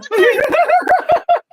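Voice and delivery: shrieking burst of laughter; loud high laugh. Real, not acted. Laughing at own teasing nickname, loud high cackle. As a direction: cackle at your own joke. Friend's teasing joke lands.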